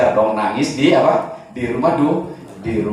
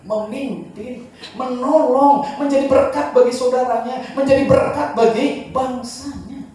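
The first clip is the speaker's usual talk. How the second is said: higher, louder, building praise; soaring preaching; 13 semitones higher, 4 dB louder than usual. Acted. Preacher to congregation about Joseph, soaring praise. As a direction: lift the congregation with each phrase.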